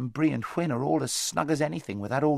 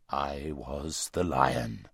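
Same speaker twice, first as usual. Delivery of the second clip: low, slow, huge voice of a great lion; large deep voice; 8 semitones lower, much slower than usual. A narrator's reading. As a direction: speak low and vast, unhurried. Lion reveals himself.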